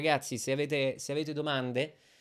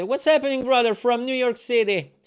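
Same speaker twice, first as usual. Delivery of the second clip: excited shout; 10 semitones higher, 11 dB louder than usual. Real, not acted. Greeting a brother on stream.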